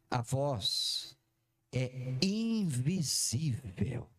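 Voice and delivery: low, slow, drawn-out reveal. Shown as a demonstration. Preacher unveiling the big revelation, slow. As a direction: let each word sink in slowly. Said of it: "Não, acho que você não entendeu".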